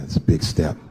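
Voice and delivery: low-pitched voice of a confiding friend; friend's voice. Acted. Groom confides in married friend, low-pitched. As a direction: drop well down for the friend's line. The friend's line in acted dialogue.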